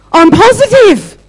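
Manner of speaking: very high, loud, drawn-out put-on voice; hammed-up high-pitched declaration; squeaky imitated answer. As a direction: squeal it slowly, imitating her. Acts out woman's squeaky answer.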